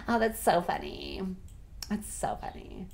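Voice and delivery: soft aside fading to a whisper; quietly amused. Real, not acted. Player tickled that it means bottom, hushed amusement. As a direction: murmur it, tickled, trailing into a whisper.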